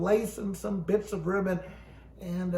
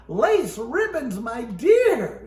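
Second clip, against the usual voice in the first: exasperated; 4 semitones higher, 7 dB louder, much slower than usual. Acted. Exasperated wife speaks slowly.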